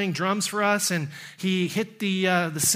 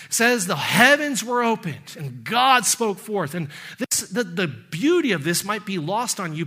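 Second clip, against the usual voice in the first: rising, higher preaching toward a climax; building sermon; 4 semitones higher than usual. Acted. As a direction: build the passion line by line.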